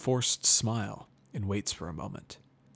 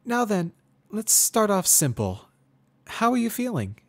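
put-on much higher, louder young mare, forced friendly; Twilight Sparkle; 13 semitones higher, 8 dB louder than usual. A narrator's reading. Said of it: "she asks me, trying to stir some kind of conversation".

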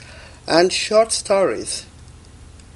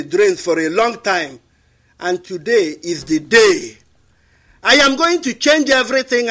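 loud, rising African chief's rally speech; kingmaker speech; 5 semitones higher, 3 dB louder than usual. Shown as a demonstration. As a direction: rouse the people like a kingmaker. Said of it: "give me a line as a kingmaker".

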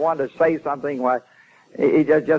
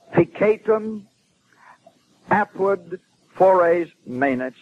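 slow, higher Latin recitation; recited Latin lament; 5 semitones higher, much slower than usual. Retold or imitated. Preacher quoting a man's words to himself, slow and declaimed. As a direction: draw out each Latin word with pauses between. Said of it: "then he said to himself".

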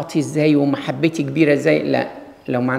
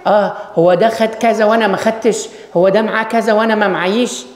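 envious whine; 5 semitones higher than usual. Retold or imitated. Envious believer complains he got less than others, mock jealous whine. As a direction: grumble like someone envious of a neighbour. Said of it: "أبص وأغير من الآخر وأقول".

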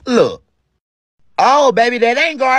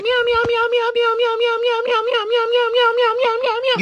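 meows much higher, like a cat; meowing cat. Acted. Cat arguing with her husband in meows. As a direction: argue back in pure cat meows.

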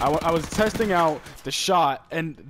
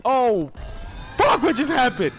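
much higher and louder, yelling oh over and over; real stunned excitement; 9 semitones higher, 7 dB louder, much slower than usual. Real, not acted. Gamer yelling in disbelief at a surprise multi-kill, real excitement. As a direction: shout in disbelief as the kills land.

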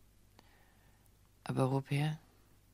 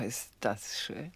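awed whisper; 6 semitones lower, 6 dB quieter, much slower than usual. Acted. Woman admires gift bracelet.

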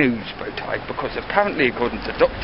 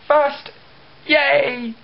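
"First, yay" much higher, silly cheer; mock cheer; 11 semitones higher, 3 dB louder than usual. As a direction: parody the gloating commenter. Mocking commenter quote.

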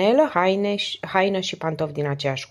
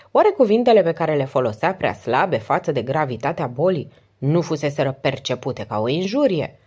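plain reading voice

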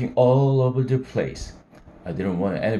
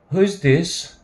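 higher-pitched cold lady on the phone; cold lady's voice; 6 semitones higher, 3 dB louder than usual. A narrator's reading. Grandmother answers a late drunken call, cold. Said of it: "This very cold lady's voice said".

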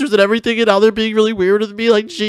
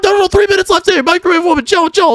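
panicked; 11 semitones higher than usual. Real, not acted. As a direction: beg her to stop in a rush. Timer lowered, panicking.

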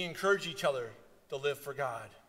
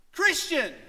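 high, loud, driving sermon voice; preaching at full force; 12 semitones higher, 11 dB louder than usual. Acted. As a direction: drive the warning home from the pulpit. Preaches the story's lesson.

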